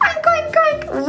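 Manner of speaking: squeaky high oinking; high pig oinks. Shown as a demonstration. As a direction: oink like a little piggy. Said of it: "act like a piggy with me".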